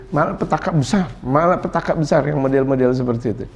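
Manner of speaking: ordinary talk, nothing marked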